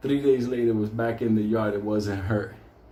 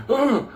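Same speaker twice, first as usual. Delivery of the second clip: high loud yelp; high imitated yelp; mock dog yelp; 13 semitones higher, 6 dB louder than usual. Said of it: "you start to hear".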